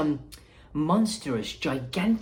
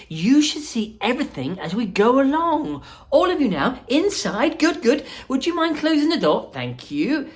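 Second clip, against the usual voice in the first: high, lively showman voice; bubbly chocolatier welcoming guests; Willy Wonka voice; 9 semitones higher, 4 dB louder than usual. Acted.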